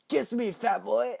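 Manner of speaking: high, loud creepy clown voice through a laugh; Pennywise. Acted. Clown taunting Ben mid-kiss, high laughing voice. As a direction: taunt him like a grinning clown. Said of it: "Pennywise looks up at him and he's like".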